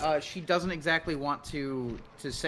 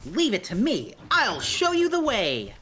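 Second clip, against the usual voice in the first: overacted anime dub; 9 semitones higher, 5 dB louder than usual. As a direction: ham up a heroic anime lead. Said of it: "he just sounds like a total anime pro tag".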